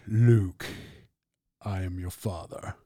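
low, soft, deep Vader voice; Darth Vader. Retold or imitated. Quoting Star Wars for viewers, a Darth Vader impression. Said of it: "then he's like".

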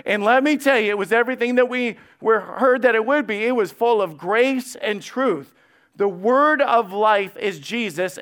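loud, high, fervent preaching; preaching at full force. Acted. Preacher to congregation, fervent full-voiced preaching. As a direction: build the congregation up with fire.

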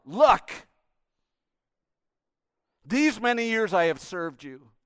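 loud, aggrieved outburst; bitter son confronting father; resentful son. Acted. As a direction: throw years of service at him. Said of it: "he answered his father".

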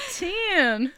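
high, loud, stressed "Ten."; emphatic shout. Retold or imitated. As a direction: hammer the word like a fan. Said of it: "like, so emphatically".

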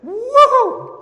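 a high, loud whoop of joy; excited woo-hoo. Real, not acted. Preacher whooping with excitement before the church, loud whoop. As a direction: let the joy burst out. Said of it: "I'm getting excited again".

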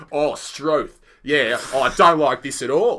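loud, hammed-up stage Australian accent; broad Australian accent. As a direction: put on a pretend broad Australian twang. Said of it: "broad, almost pretend Australian accent".

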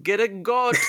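put-on high playful Jamaican accent. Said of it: "in the Jamaican accent".

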